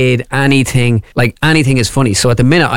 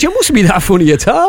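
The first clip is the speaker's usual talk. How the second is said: high-pitched, dismissive Dublin delivery man; comic incredulous north Dublin voice; classic Dublin accent; 8 semitones higher than usual. Acted. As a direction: take him down a peg in high Dublin. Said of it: "that was classic Dublin".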